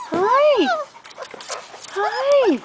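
high cooed hi; puppy talk. Real, not acted. Greeting a puppy.

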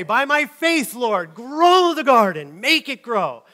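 loud, high, slow begging to the Lord; hammed-up loud plea to God; mock pleading prayer. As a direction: pray it big and drawn-out like a desperate gardener.

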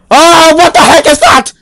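loud, high startled cry; startled shout. Acted. Spotting something strange in the house, loud startled shout. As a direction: jump at the sight and yell it.

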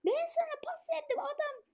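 high, soft, pleading whine; exasperated complaint. Real, not acted. Gamer scolding Alberto for the missed pass, exasperated whine. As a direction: plead with the player in disbelief.

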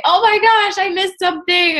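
sudden high alarmed exclamation; panicked gasp. Retold or imitated. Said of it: "I'll say".

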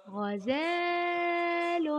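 "غزال" held high on the alif, very slow; lengthened fatha. Shown as a demonstration. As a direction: stretch "غزال" right out: ghazaaaalun. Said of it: "يطيل صوت الفتحة قليلًا".

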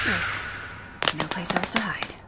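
terrified whisper. Acted. Cassie hides from the presence, a fading terrified whisper. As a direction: whisper fading to almost nothing. Hiding in fear.

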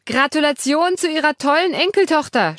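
high, loud congratulation at a deaf man; shouting. Acted. Katharina shouts praise at the deaf grandfather. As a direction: shout it into his ear. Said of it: "schreit Katharina meinen Großvater an".

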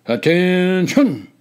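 drawn-out drill call, high and loud; shouted command. A narrator's reading. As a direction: bellow the order slowly across the ranks. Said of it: "he shouted".

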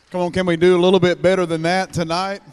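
loud, high call to the crowd; rallying shout. Real, not acted. Rallying congregation for louder praise.

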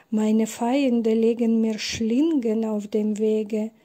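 ordinary delivery

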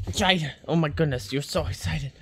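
usual speaking voice

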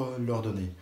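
ordinary talk, nothing marked

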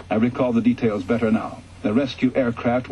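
ordinary delivery, nothing marked

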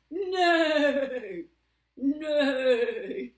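loud, drawn-out whinny; horse neigh. Pretend. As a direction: neigh like a horse at full volume. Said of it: "And the horse says".

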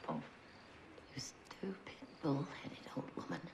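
exasperated whisper under the breath. Acted. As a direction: hiss it to yourself, fond and fed up.

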